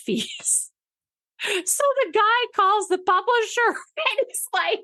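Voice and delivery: high, drawn-out words through laughter; real laughter breaking into the storytelling; laughing while talking. Real, not acted. Host telling of a call to the publisher, cracking up.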